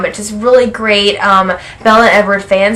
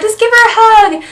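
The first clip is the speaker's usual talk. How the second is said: urging voice; 13 semitones higher, 4 dB louder than usual. Retold or imitated. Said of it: "someone was like".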